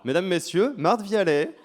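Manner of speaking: loud, high showman introduction; announcer voice. Real, not acted. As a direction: present her like a ringmaster. Announcing a guest to the crowd.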